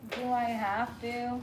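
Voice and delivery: a mild, slow imitation of a reluctant teen; half-hearted mock whine of a bored daughter. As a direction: drag it out as if unwilling. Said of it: "Ellie's like".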